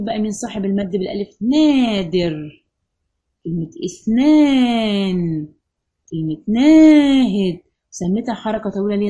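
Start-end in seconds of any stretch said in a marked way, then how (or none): 1.4-7.6 نادر, اثنان, ناهد said very slowly with the aa held; each word slow, with a long, held aa; stretched long a. Shown as a demonstration. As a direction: draw out each long aa as far as you can. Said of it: "لأن أنا بطول وبمد".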